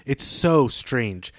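ordinary talk, nothing marked

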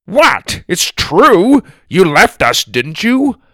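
high, loud accusation; defensive retort. A narrator's reading. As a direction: throw the blame right back. Grandparents quarrel after her snap.

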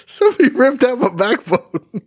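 high-pitched silly wail; mock victim voice. Co-host jokes as the backbone victim, high mock voice. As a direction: put on a high, comic victim voice.

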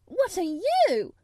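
high, loud, indignant; indignant retort. A narrator's reading. Mary retorting to Colin, indignant. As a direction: throw the accusation back. Mary throws accusation back.

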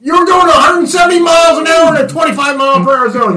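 hammed-up high ticket threat; mock officer's scolding. Mock officer reading out an absurd offense.

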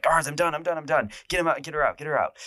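high, frantic repeated pleas; freaking out. Retold or imitated. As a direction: imitate a panicked man calling for the guards. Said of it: "yelling, going crazy".

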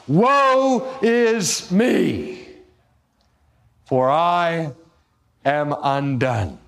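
Isaiah crying out before God, a slow anguished woe; cry of terror. Retold or imitated. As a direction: stretch out woe like a man undone. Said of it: "he cries out in terror".

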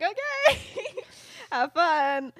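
very high, loud mock okay; acted breezy send-off. Friends waving Remus off before class, in a high mock-cheery voice. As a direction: squeal it as the friends, breaking into a laugh.

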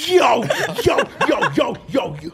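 loud, excited stream of yos; Flacco. Retold or imitated. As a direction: fire off a hammered-up repeated yo. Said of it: "That's the best actually impression I've heard".

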